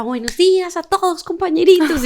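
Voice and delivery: high, bright-voiced mock greeting to the whole team; sing-song boss greeting. Boss greets her workers, high sing-song mimicry. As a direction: chirp it like a cheery boss walking in. Imitating boss's daily greeting.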